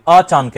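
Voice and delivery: "अचानक" as ए-चान-कै, न joined to चा; wrong break. Shown as a demonstration. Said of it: "अचानक की ध्वनि नहीं आएगी". ("अचानक" को अ-चान-कै बोलें)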